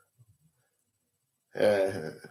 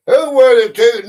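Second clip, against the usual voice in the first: higher, louder quizmaster voice; quiz question read-out; 7 semitones higher, 12 dB louder than usual. Real, not acted. Host puts a history question to players, loud quiz read-out. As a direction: put the question to all the players.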